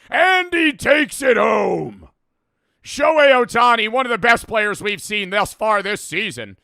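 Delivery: mock sports announcer. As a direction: belt it like a TV play-by-play man. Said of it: "They talk more obnoxiously".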